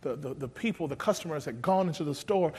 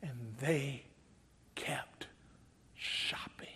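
softer, fading into a whisper; tearful whisper; 4 semitones lower, 12 dB quieter, much slower than usual. Retold or imitated. Employee telling a newscaster, in a tearful whisper. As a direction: let it die away in a whisper. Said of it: "With a tear streaming down her face".

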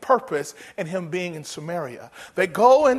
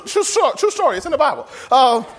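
usual speaking voice

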